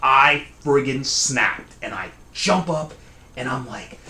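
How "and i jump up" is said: ordinary delivery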